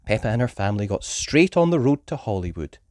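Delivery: ordinary delivery, nothing marked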